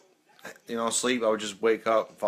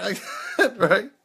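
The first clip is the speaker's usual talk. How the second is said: laughing; 10 semitones higher than usual. Real, not acted. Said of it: "laughing right after the scream".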